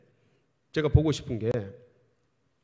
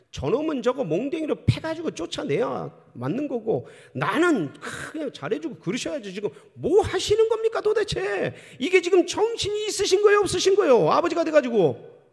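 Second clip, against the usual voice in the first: much higher, louder indignant yelling; furious shout; 13 semitones higher, 5 dB louder than usual. Acted. Elder son yells at father for welcoming brother, furious shout. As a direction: scold your father at full voice.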